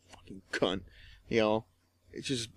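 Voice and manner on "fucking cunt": softer muttered insult; under his breath. Retold or imitated. Cursing the nurse as she walks away, softly under his breath. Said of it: "under my breath".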